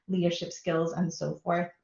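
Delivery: ordinary talk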